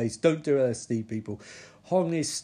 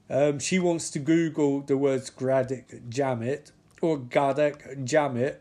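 ordinary talk

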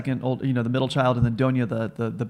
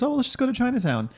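high mock voice; 11 semitones higher, faster than usual. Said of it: "And he goes".

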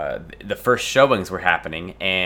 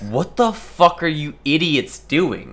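angry outburst; 6 semitones higher, slower than usual. Acted. Acted angry rebuke at the girls.

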